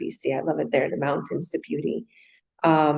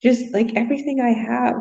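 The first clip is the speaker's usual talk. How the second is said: higher, imitating a protesting daughter; daughter's protest; 6 semitones higher than usual. Daughter protesting to her mom, mock whiny and higher. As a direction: lightly voice her teen daughter, pitched up. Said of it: "She's like".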